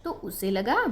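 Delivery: usual speaking voice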